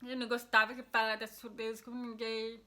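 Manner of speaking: ordinary talk, nothing marked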